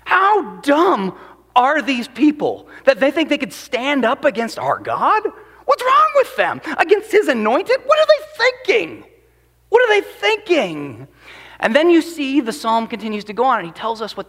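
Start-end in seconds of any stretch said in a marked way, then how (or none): 0.0-11.1 high, incredulous scoffing; pitched-up scornful ridicule; mocking disbelief. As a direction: ask it like they've lost their minds. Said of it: "the psalm starts out with this".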